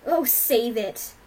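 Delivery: loud, drawn-out scornful retort; angry dismissal. A narrator's reading. Rainbow cutting off the cowering father, angrily. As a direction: cut him off loudly, stretching the words.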